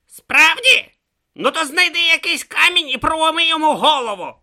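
loud, high-pitched sarcastic retort; scornful Om. A narrator's reading. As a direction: snap back loudly in a high character voice. Om's scornful retort to Brutha.

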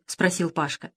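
ordinary delivery

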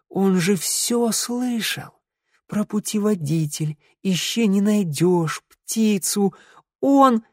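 high, slow, dawning horror; stunned dismay. A narrator's reading. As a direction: list it haltingly as the shock sinks in. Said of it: "ошарашенным взглядом". (ошарашенно, медленно, высоким голосом)

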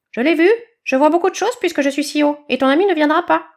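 high, loud cackling chick voice; rattle-voiced bird. Bird gloating to badger about his friend, loud and high. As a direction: squawk it out like a rattle.

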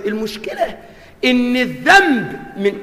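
usual speaking voice

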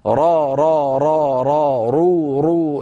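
"را، را، رو، رو" chanted with long vowels; repeated ra drill. Shown as a demonstration. Teacher modelling home practice, "را، رو" repeated as a drill. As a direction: repeat each ر with an open then a rounded vowel, "را" then "رو". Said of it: "كرر حرف الراء مرات وكرات".

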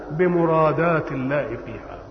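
ordinary delivery

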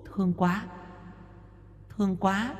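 put-on high, very soft child's whisper-like voice; a child's voice whispering in his head; faint child voice. A narrator's reading. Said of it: "tiếng trẻ con khe khẽ". (giọng trẻ con khe khẽ, cao và rất nhỏ)

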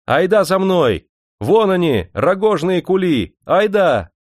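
high, drawn-out rallying calls; ringing girlish shout. A narrator's reading. Girl leading the women to the sacks, ringing shout. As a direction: rouse them to follow in a ringing young voice. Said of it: "закричал звонкий девичий голос".

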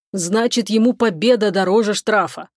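ordinary delivery, nothing marked

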